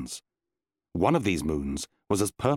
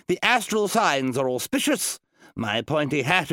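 higher, grand pronouncement with rising My pointy hat; wizard Randolph; 4 semitones higher than usual. Acted. Wizard telling his assistant the signs are good, pompous and raised.

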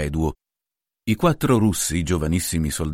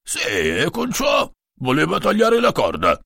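grumbling; 9 semitones higher, slower than usual. A narrator's reading. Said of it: "brontolò Fratellino".

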